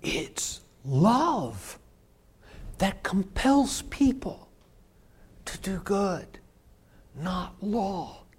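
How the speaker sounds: soft earnest sermon point. Real, not acted. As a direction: lean in and say it gently. Tender climax of sermon point.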